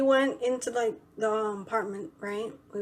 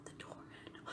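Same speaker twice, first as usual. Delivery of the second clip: barely audible, voiceless whisper; imitated whisper; 27 dB quieter, much faster than usual. Man behind her asking for the door handle, whispered. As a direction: whisper it almost to nothing.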